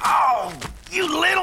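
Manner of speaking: angry shout. Acted. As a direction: yell it as the rock hits you. Villain hit by a shot.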